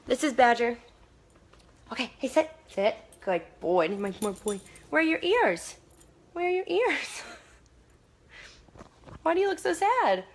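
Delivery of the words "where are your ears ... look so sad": squeaky, high pet-talk voice. Real, not acted. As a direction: coo at the dog in a high voice. Baby talk to her dog.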